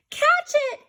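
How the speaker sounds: high, loud call to give chase; crying out. Acted. Elves urging each other to chase, loud cry. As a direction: yell at the others to grab him. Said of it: "cried the elves".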